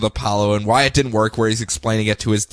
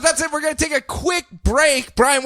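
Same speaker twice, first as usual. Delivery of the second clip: host announce; 14 semitones higher than usual. Acted. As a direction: sell the break like a DJ.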